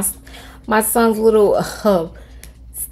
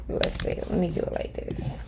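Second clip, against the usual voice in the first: whisper; 10 dB quieter than usual. Real, not acted. Quietly working while dog nearby.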